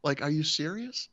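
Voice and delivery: very high-pitched, incredulous retold question. Husband seeing two companions follow him out, retold incredulity. Amazed the man had companions.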